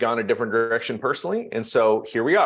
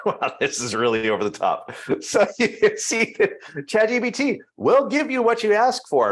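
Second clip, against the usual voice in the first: laughing through the words, pitch jumping high; real amusement; 11 semitones higher than usual. Real, not acted. Trainer amused by over-the-top ChatGPT script, laughing as he talks.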